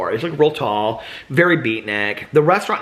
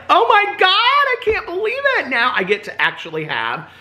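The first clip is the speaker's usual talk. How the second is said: thrilled outburst. Retold or imitated. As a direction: squeal it in overjoyed disbelief. Retelling thrilled reaction to gravy.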